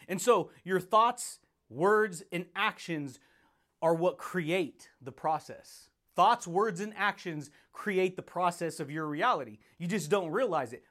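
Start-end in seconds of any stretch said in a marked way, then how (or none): 0.1-5.9 slow, weighty, word by word; slow emphasis. Real, not acted. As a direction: land each word: thoughts, words, actions. Slow emphatic teaching phrase.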